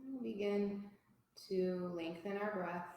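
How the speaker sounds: soft, unhurried yoga teacher voice with long pauses; guided relaxation. Real, not acted. Teacher guiding a yoga class to notice body and breath, calm guided relaxation. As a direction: settle them gently into stillness.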